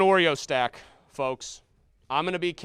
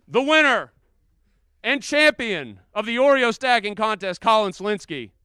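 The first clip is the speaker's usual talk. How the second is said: winner announcement; 4 semitones higher, 7 dB louder than usual. Acted.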